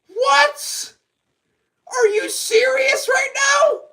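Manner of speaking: very high, stunned yells; shocked shouting. Real, not acted. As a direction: shout it like you can't believe it. Commentator reacts to consecutive backflip.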